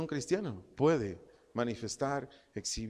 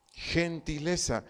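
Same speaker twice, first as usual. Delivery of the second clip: ordinary talk, nothing marked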